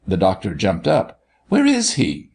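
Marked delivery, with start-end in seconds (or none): 1.5-2.3 high, urgent question; eager demand. A narrator's reading. Doctor jumps up to reach his patient, eager. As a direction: leap up in pitch, keen to be off. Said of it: "demanded with professional eagerness".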